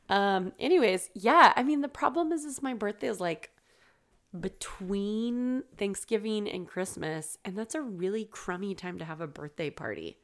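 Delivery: ordinary delivery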